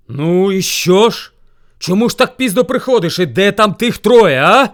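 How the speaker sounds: loud, high, impatient questioning; demanding bear. A narrator's reading. Bear asks hare where the others are, angry demand.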